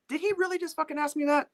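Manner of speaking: high, squeezed disbelief; incredulous inner voice. Retold or imitated. Engineer asked his opinion by the guitarist, high incredulous inner voice. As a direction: think it in a high, stunned squeal. Stunned thought, acted in his head.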